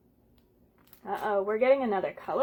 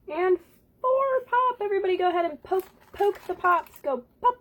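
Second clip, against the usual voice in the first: plain reading voice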